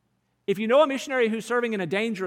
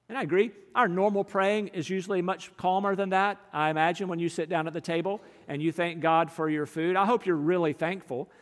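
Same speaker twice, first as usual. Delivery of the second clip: normal talking voice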